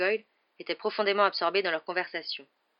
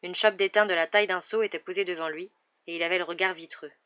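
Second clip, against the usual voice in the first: plain reading voice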